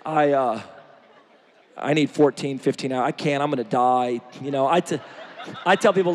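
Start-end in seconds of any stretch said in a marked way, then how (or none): 0.1-4.2 lower, stammering whine of an excuse; mock lazy friend. Preacher mimicking a friend who won't get up early, lower mock whine. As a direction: play the sleepy friend making excuses.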